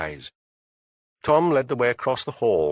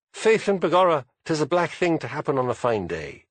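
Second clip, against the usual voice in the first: lightly put-on stage Irish accent; a hint of a stage Irish accent, quoted; mock Irish; 5 semitones higher than usual. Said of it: "How Irish?".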